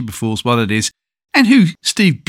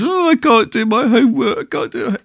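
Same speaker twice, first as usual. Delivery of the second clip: put-on high child's voice, fretting over homework; upset little boy; 10 semitones higher than usual. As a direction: play the seven-year-old close to tears. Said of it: "The kid's".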